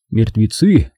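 plain reading voice

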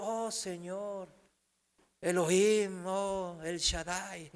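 susurro devoto y burlón, más suave y lento; falsa piedad. Retold or imitated. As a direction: imita al beato que reza en voz baja. Feligrés fingiendo piedad. (el predicador imita a un feligrés hipócrita rezando, en voz baja)

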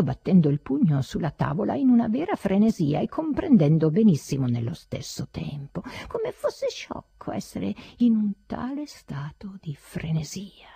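ordinary delivery

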